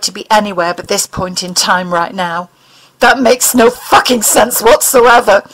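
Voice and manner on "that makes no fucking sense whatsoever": louder, higher, fed-up complaint; exasperated. Acted. Mulder fumes at the alien's riddle, exasperated. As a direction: throw up your hands with the words. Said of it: "he said, exasperated".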